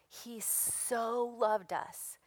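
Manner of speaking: He so loved us, slow with so drawn out; stress on so. Shown as a demonstration. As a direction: lean on "so", stretching the whole line slowly. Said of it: "We can't even measure that word so".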